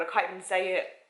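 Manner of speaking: ordinary delivery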